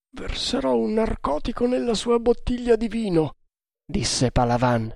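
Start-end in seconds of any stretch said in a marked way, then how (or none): 0.1-3.4 much higher, hushed Indian sailor; conspirator voice. A narrator's reading. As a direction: whisper like a loyal plotter. Palavan voiced by narrator.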